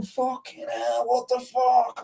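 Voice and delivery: retold vertigo panic, hammed-up; shocked outcry. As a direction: act out the terror on the burnt roof. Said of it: "I was like, I was going".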